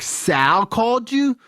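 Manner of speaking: high, slow stunned question; can't believe it was Sal; disbelief. Retold or imitated.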